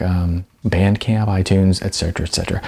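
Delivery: ordinary delivery, nothing marked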